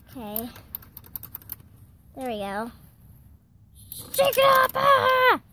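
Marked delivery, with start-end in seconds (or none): 3.9-5.4 high and loud, gleeful; squealing doll voice; excited toy voice. Pretend. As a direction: cheer it out in a high play voice. Excited doll voice while mixing.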